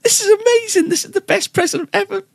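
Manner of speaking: very high, squealing delight; a wife's high, shrill-sounding rapture over her present; ecstatic gushing. Retold or imitated. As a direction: squeal like she's overjoyed. Wife thrilled by gift.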